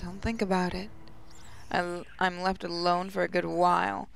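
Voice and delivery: ordinary talk, nothing marked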